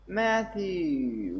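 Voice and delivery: "Matthew" shouted high and loud, the last syllable hit hard; shouted, stress on last syllable. A narrator's reading. Friends shout in unison at Matthew on the phone, stress on the last syllable. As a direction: yell "Matthew" leaning hard on the end. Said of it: "placing heavy emphasis on the last syllable".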